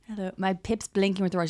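normal talking voice

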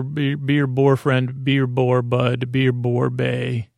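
ordinary delivery

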